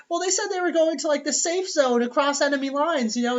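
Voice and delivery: put-on high little boy explaining; kid voice. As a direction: play the child, high and earnest.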